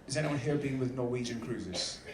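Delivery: ordinary talk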